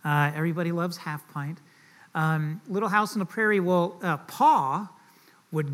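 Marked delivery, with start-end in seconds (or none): none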